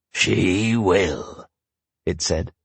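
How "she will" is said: a lightly voiced gleeful monster. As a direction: monster gloating, only a little darker. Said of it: "The monster cackled with glee".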